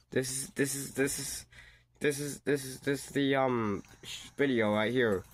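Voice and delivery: high, stuck repeating the words; can't get the words out; stunned stammer. Real, not acted. Stammering in disbelief at the date.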